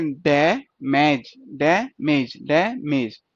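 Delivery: "डैमेज" slowly split as डै, then मेज; sounding out syllables. Shown as a demonstration. Teacher sounding out a word for students, "डैमेज" syllable by syllable. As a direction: say "डैमेज" in two drawn-out beats, short ऐ then long ए. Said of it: "word की sound क्या?".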